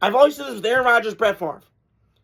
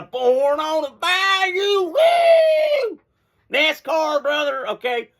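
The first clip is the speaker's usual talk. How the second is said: put-on Louisiana good ol' boy; 6 semitones higher, much slower than usual. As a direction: whoop it up like a bayou NASCAR fan.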